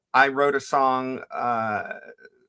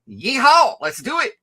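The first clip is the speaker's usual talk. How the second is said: high, loud cowboy whoop; cowboy yeehaw; 11 semitones higher, 6 dB louder than usual. Pretend. Playing cowboy persona.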